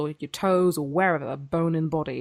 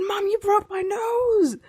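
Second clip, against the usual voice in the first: much higher, slow, crying wail to mum; half-crying wail; slower than usual. Retold or imitated. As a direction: wail it like a hurt child. Retold injury, quoting own wail.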